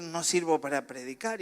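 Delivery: normal talking voice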